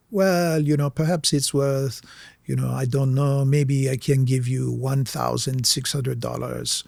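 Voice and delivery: lightly put-on European accent, unhurried; a hint of a slick European dealer. Dealer lowballing the young forger, faint European accent. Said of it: "like, European accent".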